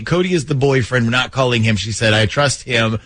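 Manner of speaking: ordinary delivery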